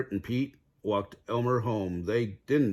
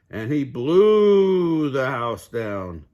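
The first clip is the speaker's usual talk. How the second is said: slow, loud and drawn out; dramatic blowing; 4 semitones higher, 8 dB louder, much slower than usual. A narrator's reading. Narrator blowing the hay house down, loud and slow. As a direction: stretch it like a huge gust of wind.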